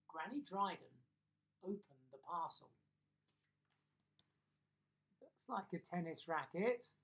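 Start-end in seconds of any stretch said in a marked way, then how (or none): none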